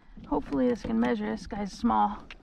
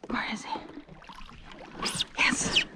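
whispering; 7 dB quieter than usual. Real, not acted. Searching for the fish quietly.

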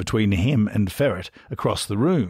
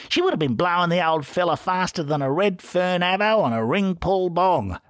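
high-pitched slangy outburst; crude, gleeful jibe; 9 semitones higher than usual. Acted. One brother jokes crudely to Bernard, high and brash. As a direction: run on high, drawing out the last words.

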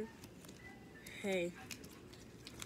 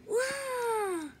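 high yelp; 3 dB louder than usual. Acted. Sudden cry.

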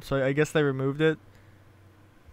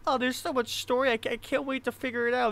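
put-on higher, eager child's voice, speeding up; little kid; 9 semitones higher than usual. Little kid eager for goldfish lore, high and excited. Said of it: "some little kid just like".

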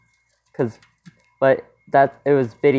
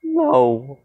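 very high, drawn-out protest; high-pitched dread; alarmed no; 13 semitones higher than usual. Real, not acted. Scared reaction in horror game.

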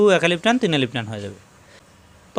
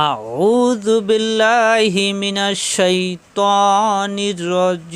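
Quran recitation; 4 semitones higher, much slower than usual. Shown as a demonstration. Teacher recites the sura aloud for students, slow melodic chant. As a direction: chant each word long and drawn out.

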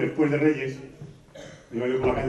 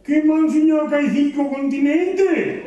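put-on child voice; 8 semitones higher, 3 dB louder than usual. Child complaining to father.

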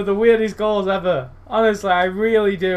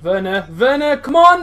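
loud shout rising up high; urging shout; 6 semitones higher, 8 dB louder than usual. Real, not acted. Gamer urging his striker on, shouting. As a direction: yell at the screen for him to go.

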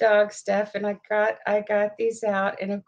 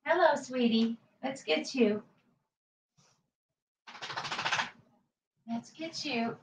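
hushed, tender words to a puppy; 9 dB quieter than usual. Real, not acted. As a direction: coo softly to the little dog. Soft voice to puppy.

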